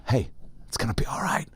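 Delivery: soft unvoiced whisper. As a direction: whisper it to calm him. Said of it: "I go".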